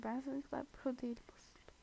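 breathy secret whisper to the viewers; whispered confession. Real, not acted. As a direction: lean in and confess it under your breath. Confiding aside to viewers.

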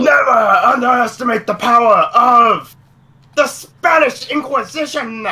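loud, high theatrical announcement; hammed-up proclamation. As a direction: proclaim it like a grand, silly threat. Hams up a comedy catchphrase.

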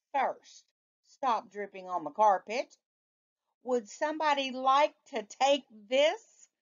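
lightly voiced father giving orders; mildly voiced dad; stern father.

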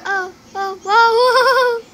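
very loud, high whoa; surprised shout. Real, not acted. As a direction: yell in shock. Sudden surprise in game.

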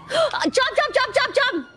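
high, rapid-fire repeated urging; urgent command. Real, not acted. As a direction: urge them on in a high, frantic voice. Urgent command during game action.

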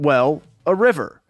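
ordinary delivery

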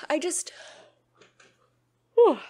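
words stretched through a yawn. Real, not acted. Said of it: "I keep yawning".

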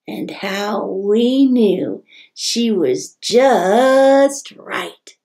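slow emphatic. A narrator's reading. Climax of story.

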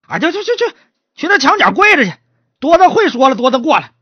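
high, impatient dismissal; exasperated magistrate. Acted. Magistrate sending the stutterer to kneel, exasperated. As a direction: shoo him off in exasperation.

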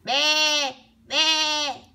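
storybook sheep bleat. A narrator's reading. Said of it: "baló la oveja".

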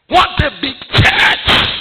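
slow booming preacher's shout; half-mock booming preacher call; preaching shout. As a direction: proclaim it loud and slow to the crowd. Preacher retold shouting to empty class.

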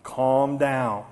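low, soft, measured calm; deadpan child settling a ranting dad; imitated four-year-old. Small daughter answering her yelling dad, low calm voice. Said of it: "And Brinley".

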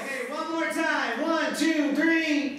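very high, projected count-in; real pep-rally leader's shout. Real, not acted. Urging audience to chant louder.

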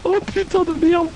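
scared horror game streamer. Real, not acted. Effrayés par un monstre.

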